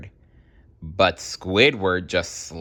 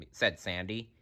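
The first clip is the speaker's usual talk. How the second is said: ordinary delivery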